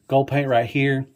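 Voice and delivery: usual speaking voice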